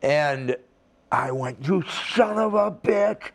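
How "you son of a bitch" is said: pained curse. Retold or imitated. Hit in the eye, cursing.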